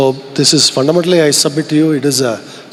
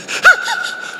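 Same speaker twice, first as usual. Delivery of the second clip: breathy laugh. Real, not acted. Laugh after Aratai remark.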